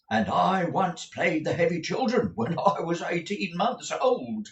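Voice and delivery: booming stagey manager asking; Mr Crummles the manager. A narrator's reading. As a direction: play the bluff old actor-manager.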